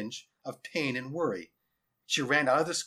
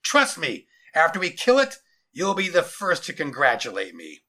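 loud, raised commander voice; self-assured military declaration; confident general; 4 semitones higher, 9 dB louder than usual. Acted. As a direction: assure the captive with forceful conceit. General's figure.

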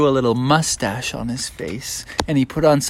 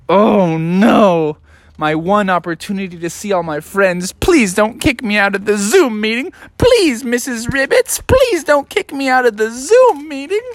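high-pitched begging, rising higher; pleading; 8 semitones higher than usual. Acted. Froggy begging Miss Ribbits not to kick him out, pleading. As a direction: beg like a desperate little frog.

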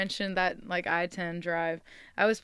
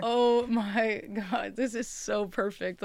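delighted; slower than usual. Retold or imitated. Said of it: "'cause I was like".

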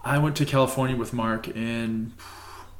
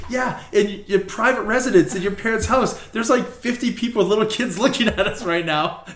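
freaking out; 8 semitones higher than usual. Retold or imitated. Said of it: "I was like".

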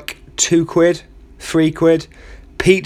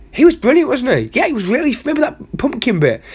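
much higher, eager fan gush; gushing soldier; 10 semitones higher than usual. Acted. Soldier gushes to his comrades about the comedian, giddy and high.

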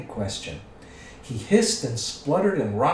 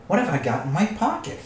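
raised-pitch question to oneself; thinking aloud; 6 semitones higher, faster than usual. A narrator's reading. Bilbo talking to himself about his pocket, aloud. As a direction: wonder it aloud, voice lifted. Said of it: "he said aloud".

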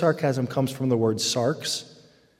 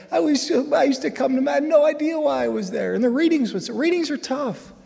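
animated high; 13 semitones higher, 4 dB louder than usual. Real, not acted. Excited storytelling, high.

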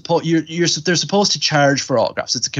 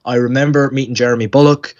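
ordinary talk, nothing marked

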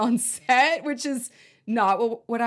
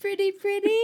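1 pretty pretty; 11 semitones higher than usual. Retold or imitated. Echoing Dawn's iconic line, higher.

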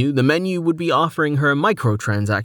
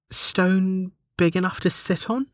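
calling out; 7 semitones higher than usual. A narrator's reading. Delta calls a request to the menu, high questioning voice. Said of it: "Delta called".

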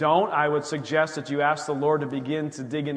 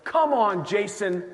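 higher and louder, mock kicking yourself; self-scolding; 5 semitones higher, 4 dB louder than usual. Jason berating himself for ignoring the warning, higher and louder.

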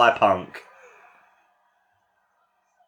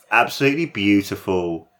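ordinary talk

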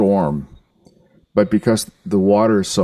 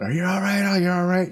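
high, fussing voice of alarmed onlookers; worried bystanders; 10 semitones higher than usual. Retold or imitated. Bystanders crowd the fallen rider, higher anxious fussing. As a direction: fret over him like a panicked passer-by. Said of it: "these people just start freaking out".

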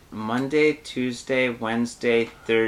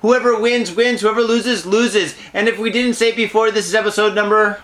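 announcer; 7 semitones higher than usual. Acted. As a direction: pump up the audience like a host. Hosting the show intro.

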